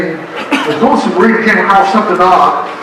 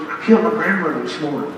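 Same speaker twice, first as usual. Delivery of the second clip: soft, quick confidential mutter; imitated whisper; 3 semitones lower, 8 dB quieter, faster than usual. President to the fourth guest, hushed and quick. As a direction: toss it off quietly under the handshake. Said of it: "he whispers".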